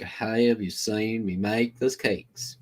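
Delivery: slow Kentucky drawl; lightly done Kentucky accent. Shown as a demonstration. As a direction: stretch it out Kentucky style. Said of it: "It's really more of, like".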